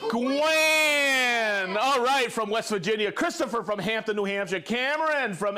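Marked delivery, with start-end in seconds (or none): 0.1-1.8 Gwen called out long, louder and higher; called-out name. Shown as a demonstration. Leading the chat in chanting a viewer's name, loudly. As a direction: belt out Gwen for everyone to join. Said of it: "Everybody say Gwen".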